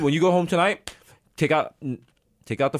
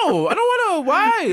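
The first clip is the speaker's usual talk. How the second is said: put-on childish whine; 4 dB louder, slower than usual. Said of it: "I'm like".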